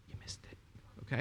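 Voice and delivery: whispered, teasing aside. Real, not acted. Preacher to congregation, whispering a gentle rebuke. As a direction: lean in and whisper it. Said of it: "whispered aside to congregation".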